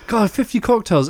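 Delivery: laughing through the words. Real, not acted. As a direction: chuckle it out. Laugh heard.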